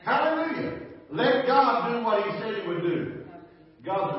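loud, high, urgent preacher; impassioned preaching, rising in pitch and volume; full-force preaching. Real, not acted. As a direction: drive it home to the congregation, building to a peak.

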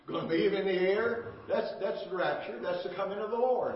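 full-force pulpit exhortation; fiery preaching. Acted. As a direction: preach it hard to the congregation. Preaching climax on rapture.

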